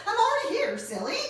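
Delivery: high, loud squeak; squeaky. Acted. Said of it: "Mouse squeaked".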